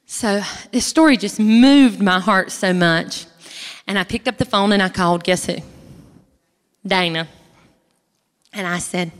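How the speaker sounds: ordinary delivery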